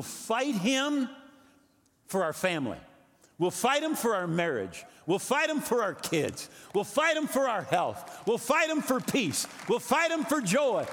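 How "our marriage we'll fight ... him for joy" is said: rally the congregation like a war cry; roaring rallying speech. Real, not acted. Pastor's climactic battle cry.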